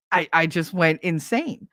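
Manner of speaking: usual speaking voice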